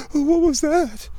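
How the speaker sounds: imitating a spooked person, high and loud; mock scared. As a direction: play the startled stranger, shrill and overdone. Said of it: "The person gets scared".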